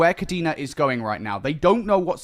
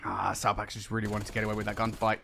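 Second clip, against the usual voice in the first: ordinary talk, nothing marked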